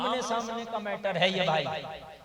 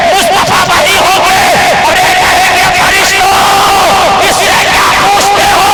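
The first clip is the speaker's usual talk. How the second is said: higher, louder, roaring sermon; shouted full-force preaching; 8 semitones higher, 18 dB louder than usual. Acted. Preacher roaring to the gathering about the grave, shouting. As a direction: shout it over the crowd.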